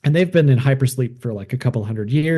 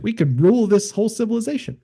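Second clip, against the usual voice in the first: a scheming hero's boast, pitched higher; lightly voiced, pitched-up ambitious astronaut; 9 semitones higher, faster than usual. Astronaut boasting to his crewmates, a lightly voiced scheming boast. Taylor scheming to conquer humans.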